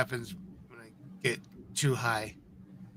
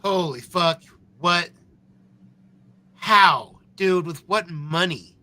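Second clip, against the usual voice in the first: sharp rising what, then a loud startled how; real stunned outburst; shocked disbelief; 3 semitones higher, 5 dB louder, slower than usual. Real, not acted. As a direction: caught completely off guard. Shocked reaction to custody claim.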